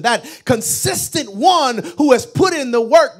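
loud, rising sermon climax; full-force preaching. Acted. Preacher pressing the congregation about showing up, shouting. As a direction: climb to a shout on each question.

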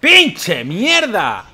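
frustrated yell. Real, not acted. Failing in game.

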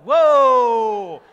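loud, high startled yelp; startled whoa. Retold or imitated. Husband recoiling from wife's tweezers, loud startled whoa. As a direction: jump back with a loud whoa. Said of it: "And I was like".

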